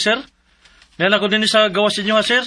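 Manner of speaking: normal talking voice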